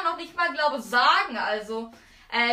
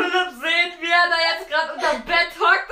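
ordinary delivery

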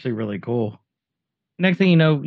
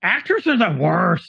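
mock outrage; 5 semitones higher than usual. Co-host quoting an actor-hater from the film, mock outrage. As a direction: declare it with hammed-up disdain. Acting out the characters' disgust at actors.

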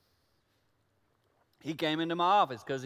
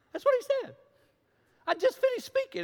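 much higher, still reeling at it; stunned disbelief. Real, not acted. Pastor recalling a friend's blunt critique, high disbelief. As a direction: squeak out the shock.

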